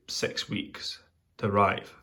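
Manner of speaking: normal talking voice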